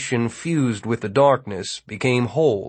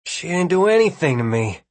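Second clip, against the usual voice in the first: raised pitch, straining to sound firm; strained, higher-pitched denial; shrilly unsteady; 5 semitones higher than usual. A narrator's reading. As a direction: try to be firm but let the pitch slip up. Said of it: "trying to make his voice firm, but it dipped shrilly".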